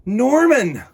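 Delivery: high, loud dramatic reveal; startled discovery of the fish; surprised. Acted. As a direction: land the name with a gasp of surprise.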